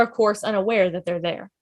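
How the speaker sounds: ordinary talk, nothing marked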